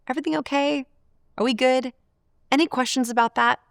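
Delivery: high-pitched, careful check-in questions; tentative, friendly high voice; gentle checking-in. Shown as a demonstration. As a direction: ask lightly, lifting the pitch to build rapport. Models gentle rapport-building questions.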